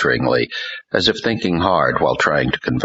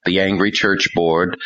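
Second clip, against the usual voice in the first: plain reading voice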